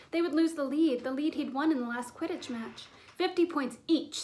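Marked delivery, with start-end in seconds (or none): 3.1-4.2 slow, loud, clipped-out verdict; a heavy-breathed stern sentence. A narrator's reading. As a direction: lay down the penalty, breathing hard. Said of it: "breathing heavily through her long".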